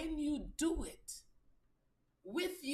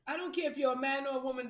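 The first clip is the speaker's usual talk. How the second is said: normal talking voice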